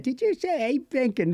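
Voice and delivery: high, churlish little English voice; cheeky botched English accent. Acted. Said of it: "cheeky monkey one that I did".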